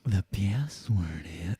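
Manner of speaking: slow breathy whisper; Cable Guy whisper. Retold or imitated. Host answering cohost with the movie whisper, slow. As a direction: lean in close and whisper. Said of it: "Is it Cable Guy?".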